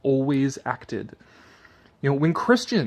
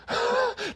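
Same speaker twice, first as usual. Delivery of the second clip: high laugh; 4 dB quieter than usual. Real, not acted. Laughs at quoted claim.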